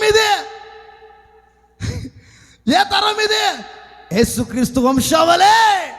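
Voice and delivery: high, full-force pulpit shout; shouted call-and-response preaching. Acted. Preacher proclaiming the lineage of Jesus Christ, shouted preaching. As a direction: fire questions and answers out like a rally cry.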